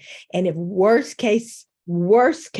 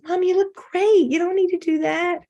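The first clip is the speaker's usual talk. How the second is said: put-on sweet child voice; 11 semitones higher than usual. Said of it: "My child says".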